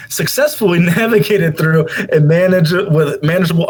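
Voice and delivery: amused stumble through the reading; laughing while reading. Real, not acted.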